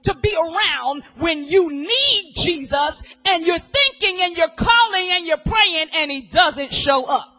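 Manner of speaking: loud preaching cadence; thundering sermon voice; full-force preaching. Acted.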